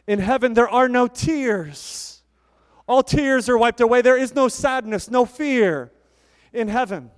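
swelling pulpit declaration; preaching. Acted. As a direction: proclaim it with rising fervour. Preaching on heaven.

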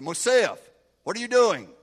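loud shout at the soldier; barked officer's challenge. Retold or imitated. Officer shouting at the soldier standing in the cold. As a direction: bark it like a commanding officer.

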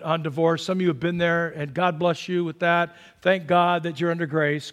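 normal talking voice

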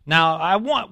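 ordinary delivery

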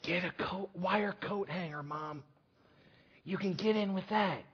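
put-on weak, very soft sick-boy whisper; hoarse whisper. Sick son answering mom's call, whispering hoarsely. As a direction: whisper it as if barely able to speak. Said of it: "in a hoarse voice, he whispered".

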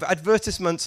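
ADVERTISEMENTS as ad-VER-tis-ments; quick British "ad-VER-tiss-ments". Shown as a demonstration. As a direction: stress the VER in advertisements, short i after. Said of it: "how I would say it".

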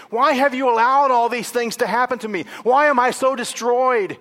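high, loud, driven plea; crying out. Acted. Psalm writer surrounded by enemies, crying out to God. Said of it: "the writer is crying out".

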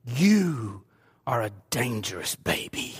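playfully deep voice for grandson; low warrior voice. Retold or imitated. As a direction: growl it low to make him a warrior. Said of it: "I said".

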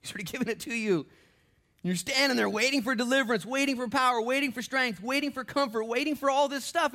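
loud, high preacher's build; preaching at full force. Acted. Preaching climax.

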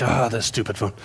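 low, soft annoyed grumble at the phone. Pretend. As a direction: mutter a fed-up complaint under your breath. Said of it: "You'd be like".

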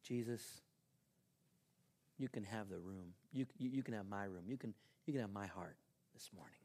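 soft, low, intimate prayer; hushed, humble surrender; sincere prayer. Shown as a demonstration. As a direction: quietly give it over to Jesus. Models prayer to Jesus.

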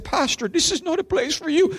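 high, pleading voice, acting out tears; imitated crying plea. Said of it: "he come up to me crying and said".

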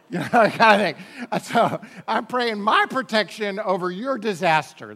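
loud laughing mockery, voice breaking into laughs; laughing loudly. Real, not acted. Pastor laughing at selfish hurricane prayers, loud and laughing.

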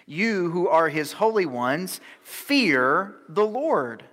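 forceful scripture reading. Acted. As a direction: proclaim the psalm.

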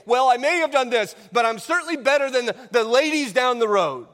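a cheating wife pleading, hurried and shrill; imitating the excusing wife. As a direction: rattle off the wife's lame excuse. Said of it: "at that time to say".

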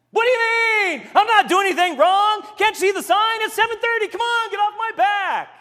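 loud, high, defensive yell; yelling back. Retold or imitated. Frank yelling back at the angry homeowner, defensive shout. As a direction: shout back in indignant protest. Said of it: "He started yelling back".

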